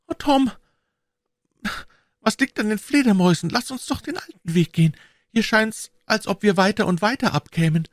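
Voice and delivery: put-on high, anxious girl's plea; a scared girl begging to go back; high anxious pleading girl; frightened girl. A narrator's reading. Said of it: "Endlich sagte sie".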